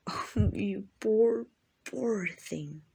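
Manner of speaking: slow, drawn-out mock sympathy; mock pity. Vampire to cornered hunter, mock pity.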